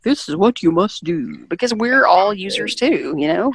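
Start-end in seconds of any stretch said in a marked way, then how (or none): 0.0-1.4 lightly stuffy lecturing tone; mock a formal instructor. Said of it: "stuffy and kind of formal".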